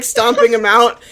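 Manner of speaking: ordinary talk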